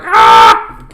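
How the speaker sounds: deep, loud yell of pain; low scream. Retold or imitated. Character screams.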